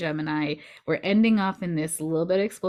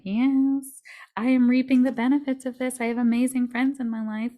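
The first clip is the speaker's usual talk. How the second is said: higher, louder mock self-affirmation; imagined triumphant reply; 6 semitones higher, 3 dB louder than usual. Listener who has done the work, imagined triumphant reply. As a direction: proclaim it proudly as an imagined voice.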